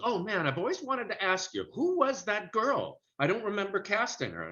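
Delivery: high, lightly voiced Jerry, curious; imitating Jerry. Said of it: "he goes".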